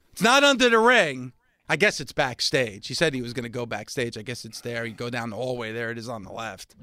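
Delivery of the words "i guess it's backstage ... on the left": quiet trailing aside. Real, not acted.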